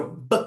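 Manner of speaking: "B" as one short burst, not the letter name; abrupt, unheld b sound; plosive B. Shown as a demonstration. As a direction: pop "B" like a bursting bubble. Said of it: "como se você explodisse uma bolha".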